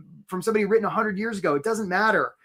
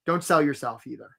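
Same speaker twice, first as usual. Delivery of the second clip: usual speaking voice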